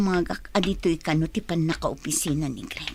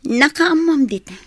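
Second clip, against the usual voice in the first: higher, louder angry outburst; angry retort; 7 semitones higher, 7 dB louder than usual. Acted. Wife confronting the other woman, angry outburst. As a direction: lash out at her.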